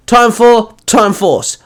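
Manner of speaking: loud, high, slow morph cry; called out. Acted. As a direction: shout it to the sky. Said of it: "Luca called out".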